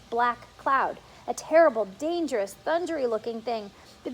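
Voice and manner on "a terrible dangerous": ordinary delivery, nothing marked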